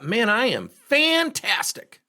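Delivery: high, slow mock-boastful salesman; mock braggart. Mocking salespeople when sales are up, high and boastful. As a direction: brag it like a puffed-up seller. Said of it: "funniest things about salespeople".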